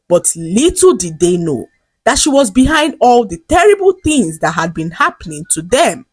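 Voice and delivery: usual speaking voice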